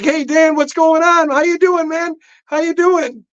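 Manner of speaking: high, bright friendly hello from the nurses; cheerful nurses' greeting. Retold or imitated.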